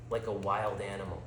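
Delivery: ordinary delivery, nothing marked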